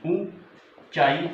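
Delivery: ऊंचाई slowly as oon, pause, chaai; broken into syllables. Shown as a demonstration. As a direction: dictate ऊंचाई in two drawn-out parts. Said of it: "कैसे लिखेंगे".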